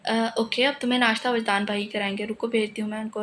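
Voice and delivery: plain reading voice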